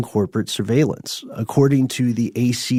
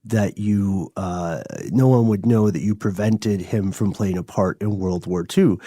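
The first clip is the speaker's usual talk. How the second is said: normal talking voice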